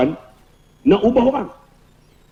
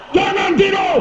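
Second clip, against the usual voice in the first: high-pitched sudden shout; booming lecture voice; 13 semitones higher than usual. Shown as a demonstration. Ustaz waking dozing listeners, startling shout. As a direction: cry out to wake the sleepers. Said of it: "suara saya kuat".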